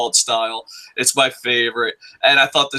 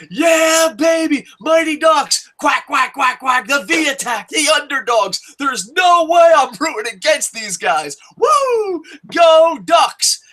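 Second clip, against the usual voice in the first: high, loud cheering shout; excited fan cheering; 11 semitones higher, 3 dB louder than usual. Retold or imitated. Fan rooting for the Mighty Ducks, high excited shouting. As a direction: whoop it up like a crazed fan.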